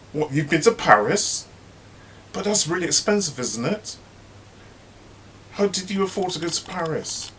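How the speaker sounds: high fake-shocked prying; nosy mock surprise. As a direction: act amazed she could afford it. Mother hen nosy surprise.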